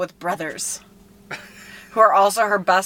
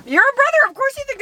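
mock accusing shout; faster than usual. Real, not acted. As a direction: fling it at him teasingly. Teasing accusation.

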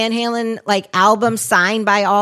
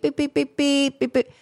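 soft mock machine beeps; imitated beeping; 5 semitones higher, 4 dB quieter than usual. Imitating the dental scanner's soft beeps. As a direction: copy the scanner's beep beep. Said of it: "the thing's going beep".

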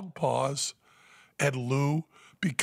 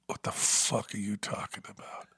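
menacing Lou; 5 semitones lower, 6 dB quieter than usual. Retold or imitated. Enraged Lou replies.

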